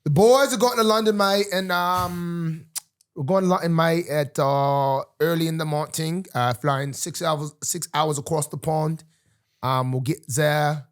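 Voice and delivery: put-on London accent. Guest telling host about the trip, mock London accent. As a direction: play at being a Londoner, badly faked accent. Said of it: "We'll learn our London accent".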